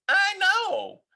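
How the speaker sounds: put-on high loud kid's cry; child crying out. A narrator's reading. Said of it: "cried Froggy".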